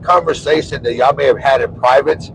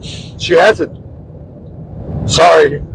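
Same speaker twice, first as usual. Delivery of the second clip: short tearful words, real; choked up; much slower than usual. Real, not acted. Husband apologizing for tearing up, choked up. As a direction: apologize through tears, haltingly. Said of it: "He's a big crybaby".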